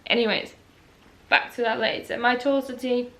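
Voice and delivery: ordinary talk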